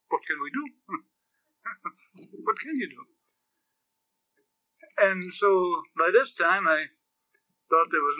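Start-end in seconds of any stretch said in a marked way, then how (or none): 0.1-3.1 softer, helpless shrug; a limp, resigned what-can-we-do; shrugging resignation. Retold or imitated. Cardinals shrugging off the priest, softer and resigned. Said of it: "just shrugged their shoulders and said".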